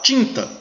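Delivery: "Tinta" with a clearly nasal "in", said high; teacher models the nasal vowel in "Tinta"; nasal vowel demo. Shown as a demonstration. Teacher showing students nasal sound in "Tinta", careful demo. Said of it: "Agora veja nasal".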